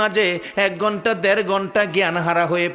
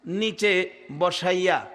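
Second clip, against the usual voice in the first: ordinary talk, nothing marked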